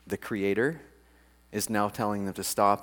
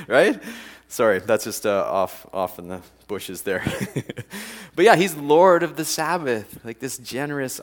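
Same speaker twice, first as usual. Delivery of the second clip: ordinary delivery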